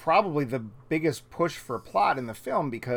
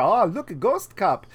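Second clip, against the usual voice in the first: delighted vampire; 6 semitones higher, 5 dB louder than usual. Retold or imitated. Voicing Viago at the mirror.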